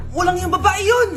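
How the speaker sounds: normal talking voice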